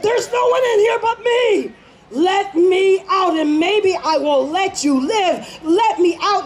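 trapped lion yells at the rabbit; lion shouting. Acted. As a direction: shout the threat through the door.

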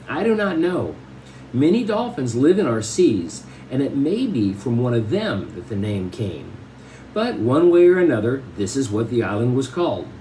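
even read-aloud storytelling voice; story narration. Acted. Teacher reading a novel to the class, steady storytelling. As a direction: read the chapter to the class with care.

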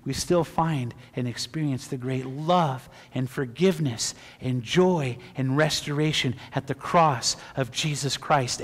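ordinary talk, nothing marked

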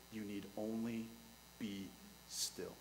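soft and low, almost breathed; hushed calm. Acted. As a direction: let the words settle them into stillness. Moses calming the people.

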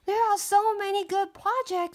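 high, eager princess; excited princess voice. Acted. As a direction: gush over the projects. Princess character dialogue.

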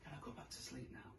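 whispered, fast and sleepy. Pretend. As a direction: whisper it like a drowsy child.

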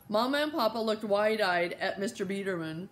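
ordinary delivery

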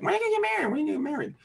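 mimicked nagging in a very high put-on voice; imitation de questionneurs insistants. Mimicking people who always ask.

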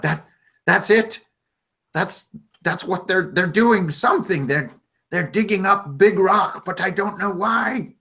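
high goblin voice, rushed and worried; a little creature looking around in alarm; frantic goblin. Acted. Vus panics at the tremor.

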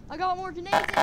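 loud, high-pitched boast; shouted threat. Acted. Fighter threatening his attackers, shouted. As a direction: yell it as a threat.

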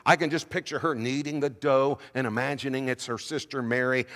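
ordinary talk

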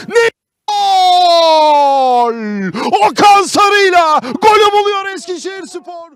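screaming football commentator. Real, not acted. Goal scored, live commentary.

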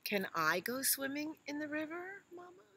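high, hopeful little elephant; high child voice. Acted. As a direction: ask like an eager calf.

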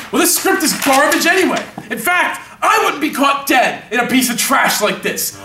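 indignant star trashing the work; angry scorn. Acted. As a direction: lash out at being rejected. Rejected actor insults the script.

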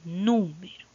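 número with NÚ punched and held; stressed first syllable. Shown as a demonstration. Teacher giving an example of written accent, NÚ stressed in número. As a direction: hit the NÚ in número. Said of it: "Se marca con una tilde".

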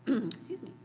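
normal talking voice

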